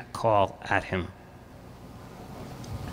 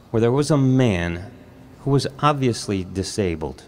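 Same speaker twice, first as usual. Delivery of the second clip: usual speaking voice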